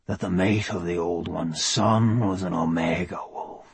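slow, low, hushed Welsh wonder; awed wonder. Acted. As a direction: marvel softly, almost whispering. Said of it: "wonder in his voice".

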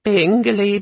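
high little electronic chirp; hopeful little gadget. A narrator's reading. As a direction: chirp it like a tiny eager machine. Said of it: "a voice ... said hopefully".

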